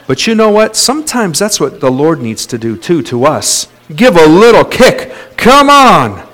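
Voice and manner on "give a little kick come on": loud, raised call to get moving; punchy preacher's exhortation, pitched up; urging shout. Real, not acted. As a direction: spur them on with a high shout. Urging congregation with a kick.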